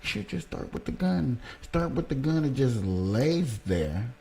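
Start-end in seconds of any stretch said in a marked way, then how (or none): 0.0-1.4 high, squeaky mock voice of the other side; mocking opponents. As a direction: mimic them in a much higher, silly voice. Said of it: "But they're gonna say".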